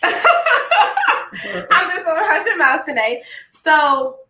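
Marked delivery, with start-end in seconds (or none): 0.0-3.2 laughing. Real, not acted. Laughing apology to Doctor Walker.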